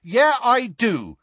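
high, loud, drawn-out angry reply; defiant anger, each word stretched; angrily. A narrator's reading. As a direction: affirm it hotly and slowly. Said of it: "said Ron angrily".

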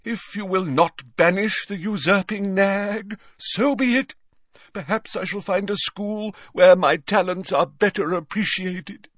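high, theatrical wounded pride; hurt pride. Acted. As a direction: storm off with bruised dignity. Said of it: "in a deeply wounded voice".

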